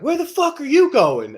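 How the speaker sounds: high, loud furious shout; mock angry yell. Retelling his hotel outburst as a high loud yell. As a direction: bark it like you've lost it. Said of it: "I was like".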